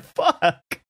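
stunned fuck. Retold or imitated. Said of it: "I was like".

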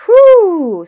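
loud high whistled whew; whistle of surprise. Acted.

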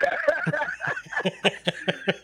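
real laughter; laughing. Real, not acted. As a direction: laugh at your own joke. Laughs at own joke.

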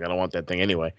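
low, quiet mock Vince; a hint of a gruff dismissive boss; imitating Vince. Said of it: "Vince would have been like".

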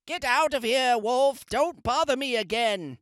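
high pig voice telling the wolf off; rude pig. Acted. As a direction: snap it like a rude little pig. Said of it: "what that rude little porker answered".

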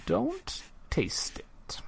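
slow, high whisper; whispered warning. Acted. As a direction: breathe the warning, very slowly. Shopkeeper warns about dragon's blood.

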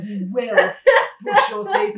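mother insisting her son brush his teeth, loud stubborn order. Retold or imitated. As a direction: lay down the law, no debate. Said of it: "I'm stubborn".

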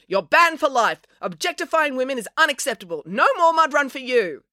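high, indignant telling-off; yelling. A narrator's reading. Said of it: "he yelled at the jocular man".

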